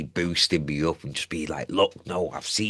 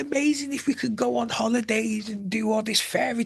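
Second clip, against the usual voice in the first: much higher, airy fantasy tone; dreamy high voice. Pretend. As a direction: swoon over the dream in a lifted voice. Daydreaming about fairy tale holidays.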